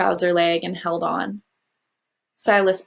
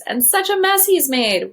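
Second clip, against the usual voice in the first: fond exclamation; 9 semitones higher than usual. A narrator's reading. Missus Owens fond exclamation.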